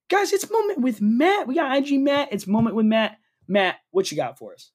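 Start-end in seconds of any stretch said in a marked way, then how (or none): 0.1-3.2 high-pitched, sing-out segment intro; segment announcer. Pretend. Host introducing Moment with Matt, high-pitched announcer hype.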